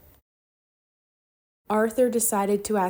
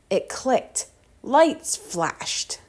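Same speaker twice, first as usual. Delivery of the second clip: ordinary delivery, nothing marked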